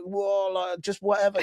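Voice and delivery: a tipsy, careless brush-off; drunk shrug. Retold or imitated. Brushing off the barman with no JD, high mock drunk. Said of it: "I'm like".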